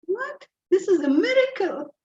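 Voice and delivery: high-pitched, incredulous amazement; amazed. Acted. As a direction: act astonished at a sudden miracle. Acting out amazement at pain going.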